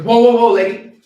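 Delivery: high, loud halting call; acted outburst of protest; loud objection.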